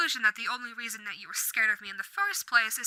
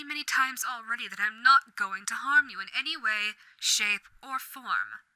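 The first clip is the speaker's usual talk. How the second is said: ordinary delivery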